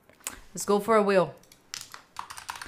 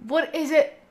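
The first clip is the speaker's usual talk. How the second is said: surprised; 6 semitones higher, 7 dB louder than usual. Real, not acted. Startled reaction on stream.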